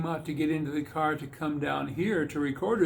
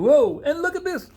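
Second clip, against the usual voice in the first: startled, much higher and louder; startled whoa; 10 semitones higher, 5 dB louder than usual. Real, not acted. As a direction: exclaim in surprise at a sudden mishap. Picks fall on the floor.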